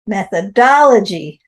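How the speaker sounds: "Methodology" with the stress on "dol"; stress on DAL. Shown as a demonstration. Teacher to the class, stress on the dal of Methodology. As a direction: hit the "dol" syllable of "Methodology". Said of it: "the stress is on the dal".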